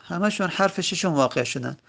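normal talking voice

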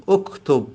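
اکتب starting with a rounded o; damme on alef. Shown as a demonstration. Teacher giving the correct form, اکتب begun with o. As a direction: say اکتب as ok-tob.

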